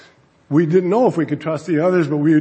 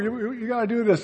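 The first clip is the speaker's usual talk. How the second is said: pleading; 6 semitones higher, 4 dB quieter than usual. Retold or imitated. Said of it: "So I'm pleading with them".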